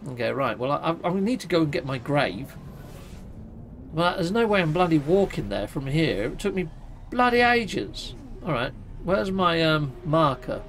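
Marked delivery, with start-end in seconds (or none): none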